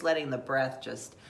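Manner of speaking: normal talking voice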